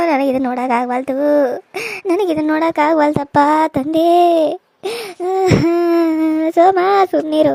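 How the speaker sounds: put-on old woman crying out to God; crying old mother. Old mother begging God to end her life, sobbing plea. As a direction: plead to God through sobs.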